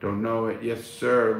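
ordinary talk, nothing marked

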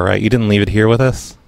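ordinary talk

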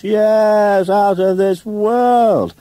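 very high, loud, stretched-out exclamation; with relish. A narrator's reading. Theatrical man repeating his praise, with loud relish. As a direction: savour every word at full theatrical volume. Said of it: "He repeated the phrase with relish".

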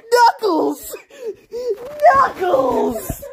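mock yell. Crying out while being attacked.

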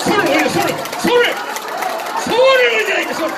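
high, loud insistent call; urging shout. Real, not acted. Pastor urges the congregation to raise hands, loud insistent shout. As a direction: push them to lift their hands.

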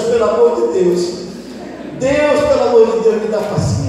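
loud, high desperate plea; pleading with God. Acted. Exasperated father begging God for patience, loud plea. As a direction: beg for patience at full voice, hammed-up. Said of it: "Olha eu pedindo a Deus".

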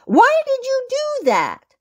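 very high, squeaked demand; high-pitched storybook mouse scolding; squeaky mouse teacher. A narrator's reading. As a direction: squeal the question like a shocked mouse. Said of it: "squeaked the Lady Mouse teacher".

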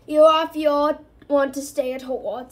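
loud, stern order; fierce. A narrator's reading. Gamekeeper puts reluctant boy in his place, fiercely. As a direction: come down hard and loud on him. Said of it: "said Hagrid fiercely".

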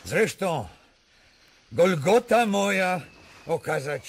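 loud, high, ringing defiance; defiant outcry. Acted. King defying the prince, loud and high. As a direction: hurl it out in defiance.

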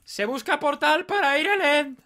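high, loud, slow proclamation; mock announcement. Joking with his friend about finding a portal, a loud slow mock proclamation. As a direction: ham it up like a wanted poster read aloud.

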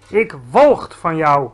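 slow, loud disgust. A narrator's reading. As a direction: spit out his contempt. GVR disgust. (langzaam, luid vol walging)

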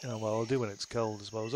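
ordinary delivery

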